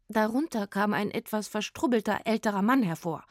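ordinary delivery, nothing marked

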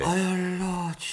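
high, astonished cry; astonished exclamation. Acted. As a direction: blurt it out in disbelief. Butler recovers, exclaims.

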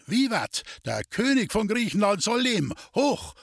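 high-pitched toast to the king; rousing cheer. A narrator's reading. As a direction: cry it out as a celebratory hurrah. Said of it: "rief der Grenzaufseher".

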